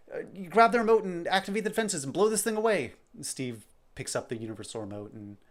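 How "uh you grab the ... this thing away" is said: higher, louder commands while dragged off; urgent order. Acted. Percy, grabbed by a tentacle, urgently orders Steve. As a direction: yell orders while being pulled away.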